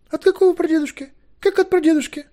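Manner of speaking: put-on high, loud, flustered girl; shrieked questions, completely thrown; bewildered wail. A narrator's reading. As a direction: cry out in utter confusion. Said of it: "завопила совершенно растерявшаяся Кередвен".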